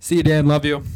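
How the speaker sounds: loud shout-out to a friend; shouted farewell. Real, not acted. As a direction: yell it across the room.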